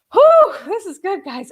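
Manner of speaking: high, loud, slow "whew"; real emotional rush; overwhelmed whew. Real, not acted. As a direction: exhale your amazement. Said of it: "All the feels tonight".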